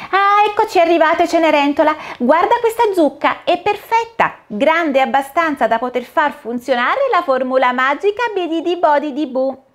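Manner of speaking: a cheerful fairy godmother casting a spell; fata smemorina. Acted. Fairy voiced in story. (voce alta e allegra da fata madrina)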